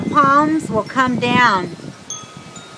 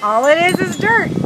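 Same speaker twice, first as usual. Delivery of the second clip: ordinary delivery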